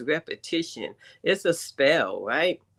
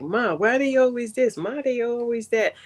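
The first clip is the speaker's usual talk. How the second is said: whiny daughter; 7 semitones higher, 3 dB louder than usual. Retold or imitated. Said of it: "she gonna be like".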